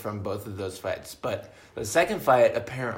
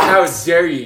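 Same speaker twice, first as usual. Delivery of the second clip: louder hammed-up indignation; mock outrage; 2 semitones higher, 9 dB louder than usual. Joking about his own bar-fight outrage, loud mock anger. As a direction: bark it like an offended drunk.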